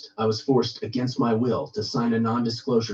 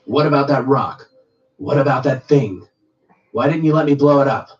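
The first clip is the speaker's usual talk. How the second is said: raised, louder, insistent challenge; agitated demand; 4 semitones higher, 7 dB louder than usual. A narrator's reading. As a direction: confront a comrade with loud, punchy questions. Guard demands answers from his comrade.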